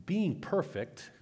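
PERFECT set apart in audible quote marks; air quotes. Shown as a demonstration. Preacher quoting a term for listeners, set off in air quotes. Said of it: "I'm using air quotes for those listening".

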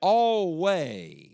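Always said loud and ringing; stressed Always. Shown as a demonstration. Preacher stressing the word always, loud. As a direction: hit the word Always hard. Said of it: "What's that word?".

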